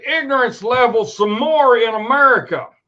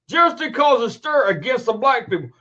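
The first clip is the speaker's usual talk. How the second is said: usual speaking voice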